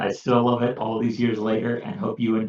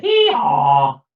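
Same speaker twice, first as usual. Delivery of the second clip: louder hee haw; donkey bray; 6 dB louder than usual. A narrator's reading. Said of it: "The donkey said".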